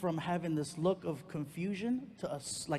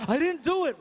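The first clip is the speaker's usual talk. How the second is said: put-on defensive kid; 11 semitones higher, 10 dB louder than usual. Kid voiced.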